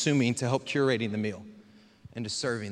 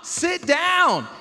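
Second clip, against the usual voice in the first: much higher, louder whining plea; frustrated children calling to their mother; exasperated kids pleading; 11 semitones higher, 6 dB louder, much slower than usual. Retold or imitated. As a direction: beg mom to sit down, pitch climbing. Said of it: "We'd be like".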